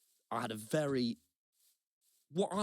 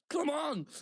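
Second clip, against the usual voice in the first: a sudden higher, pushing cry; straining come on; 14 semitones higher than usual. Retold or imitated. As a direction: urge her on with frustrated effort. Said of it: "So now I'm really trying to go".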